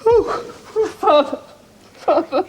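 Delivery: high, broken cries; sobbing. Acted. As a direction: break down weeping.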